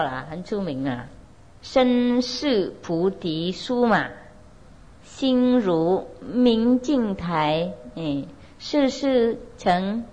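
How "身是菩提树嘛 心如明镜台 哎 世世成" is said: very slow, drawn-out syllables; slow verse recitation. Shown as a demonstration. Teacher reciting Shenxiu's famous verse to students, slow recitation. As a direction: recite it word by word, stretching each.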